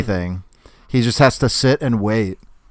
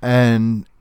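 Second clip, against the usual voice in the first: usual speaking voice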